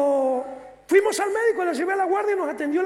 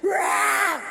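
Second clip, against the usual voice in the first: a retold scream torn out of the throat, half-wailed; demonic scream; 4 semitones higher, 3 dB louder than usual. Possessed woman crying out during deliverance, harsh wailing scream. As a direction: re-enact the possessed woman's cry as she falls.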